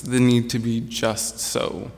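ordinary delivery

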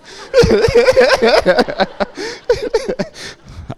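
long, high, loud laughter; long real laugh. Real, not acted. Recalling Caitlyn asking how he knew, long high laugh. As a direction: crack up at the memory.

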